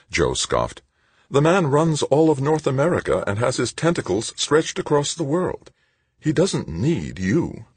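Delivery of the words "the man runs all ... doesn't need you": lightly higher, scornful woman's voice; scoffing young woman. Acted. Joe's voice in a story.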